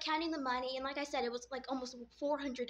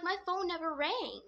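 higher-pitched pleading insistence; retold protest; 5 semitones higher than usual. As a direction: protest your innocence to an annoyed brother. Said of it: "I'm trying to tell him".

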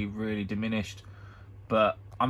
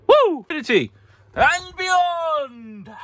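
a high, hammed-up hero shout; Buzz Lightyear cry; 5 dB louder, slower than usual. Hyped seller bursts into a high, hammed-up hero cry. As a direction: belt it out like a toy space ranger.

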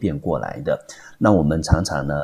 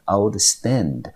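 outstand drawn out slowly, the two parts kept clear; slow demonstration; 4 semitones higher than usual. Shown as a demonstration. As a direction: spell out outstand slowly for the class. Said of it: "把它对调过来".

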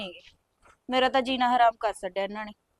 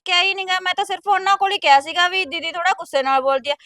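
higher, much louder defensive outburst; angry protest; 5 semitones higher, 11 dB louder than usual. Acted. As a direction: fire back hotly, voice rising in protest. Bride argues back with her sister-in-law.

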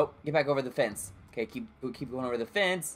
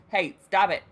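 usual speaking voice

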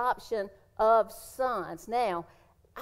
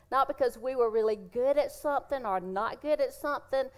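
higher, louder joyful preaching; preaching at full force; 4 semitones higher, 3 dB louder than usual. Acted. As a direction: lift the room with the good news. Preaching climax on adoption.

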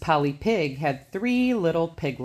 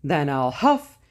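louder, huffing big bad wolf; 4 semitones higher, 4 dB louder than usual. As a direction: blow hard like a wolf. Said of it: "said the wolf".

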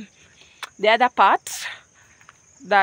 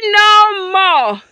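emphatic reply; 8 semitones higher, 9 dB louder than usual. Pretend. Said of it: "And I'll be like".

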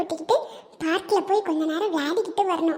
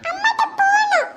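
put-on much higher child voice wanting mum; whiny toddler; 11 semitones higher than usual. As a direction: plead like a little boy. Toddler wants his mother.

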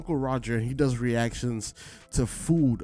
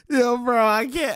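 much higher and louder, can barely speak; cracking up; 12 semitones higher, 6 dB louder than usual. Real, not acted. Vlogger losing it over the funny voice, high laughing. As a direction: overcome by laughter, squeaking it out.

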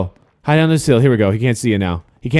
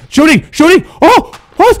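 very high, loud frantic yells; excited shouting; 6 dB louder than usual. Real, not acted. Player shooting the puck, excited shouting. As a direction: yell it mid-shot, thrilled. Shot on goal in hockey.

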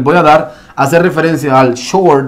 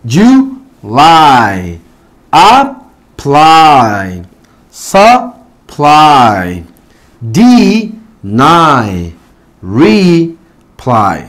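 you lie, apply, supply, deny, reply split in two, long LIE, PLY, NY; stress on final syllable; much slower than usual. Shown as a demonstration. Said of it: "la sílaba fuerte en este caso". (sílabas separadas, acento fuerte y alargado al final)

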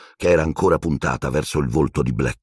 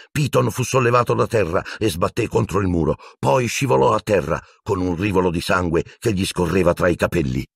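usual speaking voice

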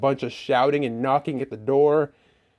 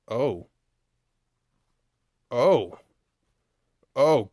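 ordinary delivery

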